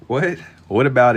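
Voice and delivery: surprised. Real, not acted. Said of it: "Is this art style W?".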